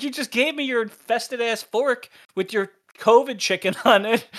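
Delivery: amused, hammed-up accusation; mock outrage. Retells partner's outraged reaction to covid.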